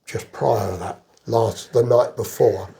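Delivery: ordinary delivery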